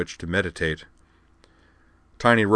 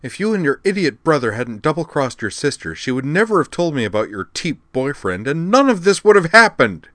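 louder, higher, rising to a furious cry; snarled; 8 semitones higher, 8 dB louder than usual. Acted. Liza hurls blame at Amanda, furious. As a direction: snarl the accusation, building to a yell. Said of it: "Liza snarled".

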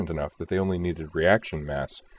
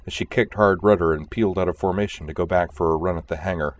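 ordinary delivery, nothing marked

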